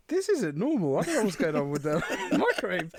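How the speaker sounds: put-on a confused kid staring at the microwave; puzzled kid. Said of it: "I'm thinking".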